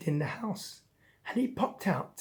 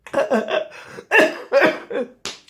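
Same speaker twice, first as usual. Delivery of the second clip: loud, high hammed-up sobbing; choking sobs; 8 semitones higher, 8 dB louder than usual. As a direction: cry in choking bursts. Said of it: "Choking on his crying".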